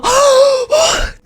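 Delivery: high, drawn-in gasp of delight; mock girly gasp; excited gasp. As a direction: gasp like a dream came true. Voicing Kiana's excitement.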